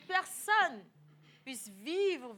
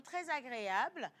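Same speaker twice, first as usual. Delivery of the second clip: normal talking voice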